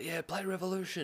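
high, held-back mock yell; retold yell. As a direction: imitate calling a request to the stage. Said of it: "so I yell out".

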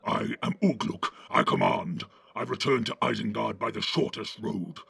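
growling orc commander; rough, commanding orc growl; Ugluk. A narrator's reading. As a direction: growl out who is in charge. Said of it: "said the growling voice".

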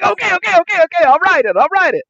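high, loud frantic student imitation; panicked surrender. Student to teacher about the essay, mock panicked surrender.

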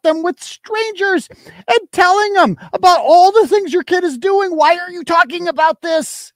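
high, heated scolding; indignant rant. Real, not acted. Ranting at the absent mother.

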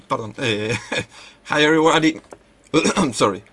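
ordinary talk

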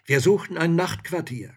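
loud, high soldier's request; forthright soldier. A narrator's reading. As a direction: speak up boldly as a soldier. Soldier asks for lodging.

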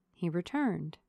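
ordinary delivery, nothing marked

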